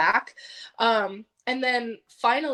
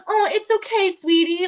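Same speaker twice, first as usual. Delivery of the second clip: high, louder baby-talk imitation; condescending; 8 semitones higher, 6 dB louder than usual. Upline talking to her like a baby, condescending. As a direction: coo down at them like a little kid. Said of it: "like, this condescending voice".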